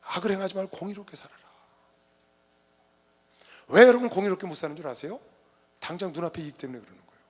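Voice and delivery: ordinary delivery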